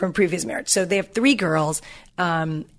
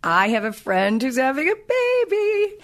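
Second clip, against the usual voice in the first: usual speaking voice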